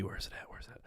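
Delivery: very soft, whispered search; whispering to self. Real, not acted. Streamer hunting for the locals chat, whispering to self. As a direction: whisper it under your breath.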